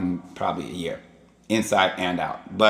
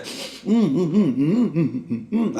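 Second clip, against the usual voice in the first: mock dog sipping noises; 7 semitones higher, slower than usual. Dogs sipping, imitated noises.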